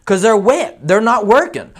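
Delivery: high, loud demanding client voice; mock angry client. Client demanding answers from the roofer, high and loud. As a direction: grill him in a high put-on voice.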